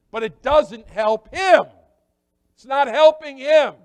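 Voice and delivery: high, loud preaching shout. Real, not acted. Preacher hammering his point.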